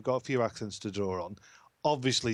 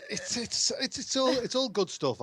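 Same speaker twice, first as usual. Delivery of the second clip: high-pitched, talking through laughter; laughing; 11 semitones higher than usual. Real, not acted. Caller asked about the hosts' squabble, laughing as he answers. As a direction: get the words out while laughing.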